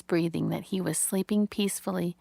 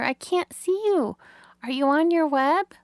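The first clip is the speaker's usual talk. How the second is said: high, louder worried little pig voice; anxious young pig; 8 semitones higher, 5 dB louder than usual. A narrator's reading. Wilbur calls into the dark for Charlotte, worried and high. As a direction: call out into the dark like a lost piglet.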